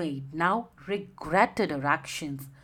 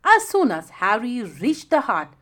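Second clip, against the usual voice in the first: usual speaking voice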